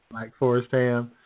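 ordinary talk

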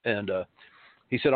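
normal talking voice

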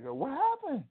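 very high mock-panicked question; startled high yelp of a question; alarmed reenactment. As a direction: act out a worried husband, pitch jumping way up. Running over, alarmed, in retelling.

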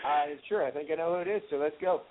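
tinny iPhone line sound; talking through a poor phone connection; phone audio. Real, not acted. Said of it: "We're having a little technical difficulties".

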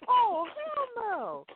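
high, slow, drawn-out "Oh, hell no" in a put-on voice. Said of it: "I'd be like".

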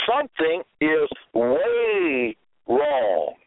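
"way" stretched long and slow for emphasis; slow right down, leaning hard on "way"; drawn-out emphasis. Real, not acted. Preacher rebuking his people, "way" drawn out emphatically.

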